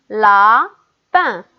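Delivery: "lapin" loud, broken into a stretched la, then pin; split into syllables. Shown as a demonstration. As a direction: pause clearly between the two syllables of "lapin". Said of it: "dans la première syllabe". (« lapin » fort, découpé en syllabes : la… pin)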